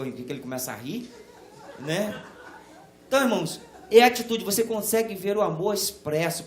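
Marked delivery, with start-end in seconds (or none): 3.1-6.4 loud, rising pulpit fervour; preaching at full force. Acted. Pastor urging the church to see love, fervent preaching. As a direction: preach it out to the pews.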